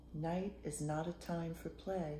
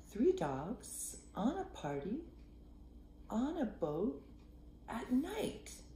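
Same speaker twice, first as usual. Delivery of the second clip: usual speaking voice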